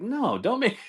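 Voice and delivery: very high, incredulous objection; mock protest. Waiter refusing to play kidnapper, acted protest. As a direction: voice jumps up to refuse.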